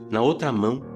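usual speaking voice

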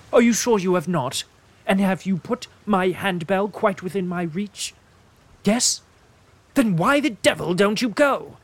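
put-on fussy old invalid, rising to an irritable snap; fretful invalid. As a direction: nag the servant like a fragile hypochondriac. Peevish invalid Mr Fairley.

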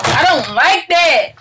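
slow, high whining tantrum; temper tantrum. Real, not acted. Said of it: "She's throwing a temper tantrum".

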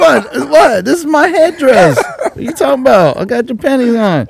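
high, laughing mock innocence; absurd denial. Mocking the angry boyfriend, high and hammed-up. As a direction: ham up the clueless act.

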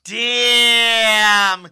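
big booming shout; loud shout. Real, not acted. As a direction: belt it out. Loud exclamation.

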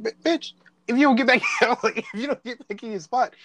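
mock threat. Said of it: "I'm like".

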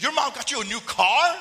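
high, very loud disbelieving question; incredulous shock. Retold or imitated. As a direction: blurt it out in mock astonishment. Retold disbelief at the gift.

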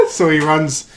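ordinary talk, nothing marked

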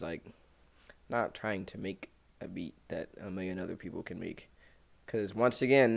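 ordinary talk

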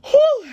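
high, loud exhaled whoop of relief; emotional whew. Real, not acted. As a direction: blow out the stress in one whew. Sighs out after stressful memory.

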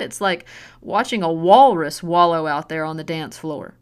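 loud, sneering taunts at her daughter; mocking taunt. Drunk mother mocking her daughter's dancing, sneering and loud. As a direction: jeer at her like she's worthless.

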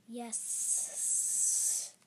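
long, drawn-out monster hiss. A narrator's reading. Monster agreeing to the job, hissing. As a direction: stretch the s like a snake. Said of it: "he hissed".

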